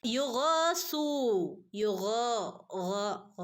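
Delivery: slow chanted tajweed recitation; Quran recitation. Shown as a demonstration. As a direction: recite it slowly, stretching the madd. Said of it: "രണ്ടനക്കം നീട്ടി ഓതി കൊടുക്കുക ട്ടോ". (ഖുർആൻ പാരായണ ശൈലി)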